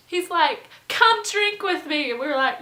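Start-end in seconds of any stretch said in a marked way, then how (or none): 0.9-2.1 high, loud mock drunk invite; rowdy urging. Drunk host urging visitors to drink, rowdy. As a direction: imitate a pushy party host.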